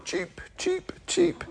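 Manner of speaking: high tiny chirps; cartoon bird voice. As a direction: squeak it like a baby chick. Officer imitates egg chirper.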